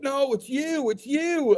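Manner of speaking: high, insistent fan voice; imitating excited fan. Fan recognizing Big D, high and insistent. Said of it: "they'd be like".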